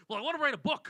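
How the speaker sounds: hammed-up older guy voice; older man. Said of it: "late fifties, early sixties".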